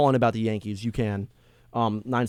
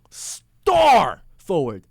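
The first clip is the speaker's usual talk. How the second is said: usual speaking voice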